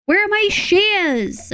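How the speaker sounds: high, slow, drawn-out whine; high plaintive whine; whiny wail. Real, not acted. Streamer searching, drawn-out wail.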